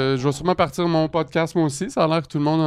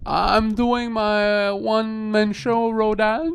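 mock English plug; 5 semitones higher, much slower than usual. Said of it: "dis-les en anglais".

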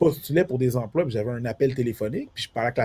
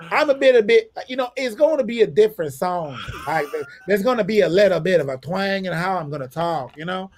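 high Southern American twang; Southern US accent; 6 semitones higher than usual. Shown as a demonstration. Showing how he blends in down South, Southern US accent. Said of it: "si je m'en vais dans le sud des États-Unis".

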